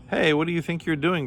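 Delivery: high, indignant storybook cry; cried out. A narrator's reading. Camel shut under the manhole, cried out. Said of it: "cried Camel".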